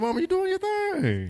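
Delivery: squeaky high sweet-talking voice; high-pitched falsetto. Retold or imitated. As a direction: pitch way up like a teasing auntie. Greeting mama in high voice.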